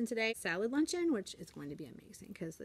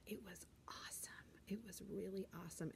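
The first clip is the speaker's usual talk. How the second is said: whisper; 14 dB quieter than usual. Real, not acted. Hushed aside about concert.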